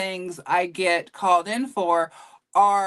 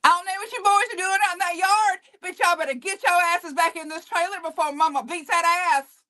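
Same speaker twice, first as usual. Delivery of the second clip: extremely country Southern mama, pitched way up; Jolene; 9 semitones higher than usual. Shown as a demonstration. Actress as trailer-park mama ordering her boys inside, high country scolding. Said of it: "let me get in Jolene".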